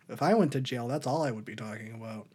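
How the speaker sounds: normal talking voice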